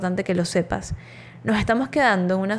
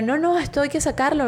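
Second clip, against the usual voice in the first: desperate refusal; 6 semitones higher than usual. Retold or imitated. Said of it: "me empecé a desesperar".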